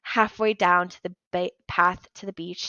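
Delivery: ordinary delivery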